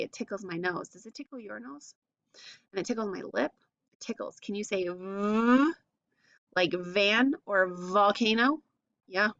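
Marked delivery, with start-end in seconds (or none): 0.0-4.4 soft giggly "it tickles"; playful tickly voice. Pretend. Teacher playing it tickles her lip, hushed. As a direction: hush it like it tickles. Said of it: "Oh, it's so tickly".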